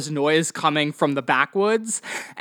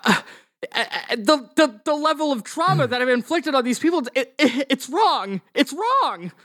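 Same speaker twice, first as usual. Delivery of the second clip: higher, halting confession; remorseful Doctor Smith; 6 semitones higher than usual. Acted. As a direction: confess with stammering regret. Game master plays remorseful Doctor Smith.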